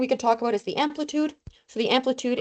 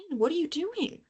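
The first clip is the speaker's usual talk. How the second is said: higher, softer exasperated question to the pen; 7 semitones higher, 6 dB quieter than usual. Real, not acted. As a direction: scold the pen in disbelief.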